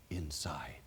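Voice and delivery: softly whispered, almost breathless; whispered confession. Retold or imitated. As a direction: let the word fade into a whisper. Teen confides his empty feeling.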